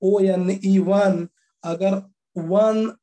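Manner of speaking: slow, deliberate spelling of वन; slow spelling demo. Shown as a demonstration. Teacher slowly spelling वन for the class. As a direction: spell वन letter by letter as ओ एन ई. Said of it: "वन लिखते हैं ना".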